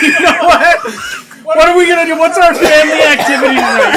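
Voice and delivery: high, loud cheerful mom voice; laughing impression of mom; mock bubbly mom. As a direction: pitch it as fun family time. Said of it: "she's like".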